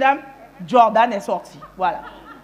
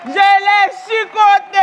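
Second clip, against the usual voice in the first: high, drawn-out full shout; gleeful confession at full volume; triumphant shout; 9 semitones higher, 10 dB louder, much slower than usual. Acted. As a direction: bellow it slowly as the punchline. Climax of punishment story.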